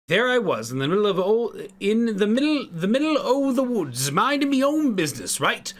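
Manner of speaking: put-on a clumsy Irish accent spinning a yarn. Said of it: "I'm bad at Irish accents".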